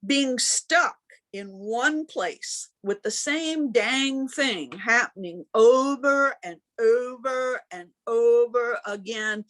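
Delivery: usual speaking voice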